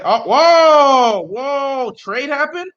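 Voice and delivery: loud, high, stretched-out whoa; real shock bursting out, then easing off; stunned. Real, not acted. Streamer reacts to a surprise draft trade, stunned.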